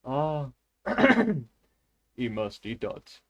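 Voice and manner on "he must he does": put-on slow, low Russian-accented reading; attempted Russian accent. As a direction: read slowly with a Russian accent. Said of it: "sounds like I'm educated".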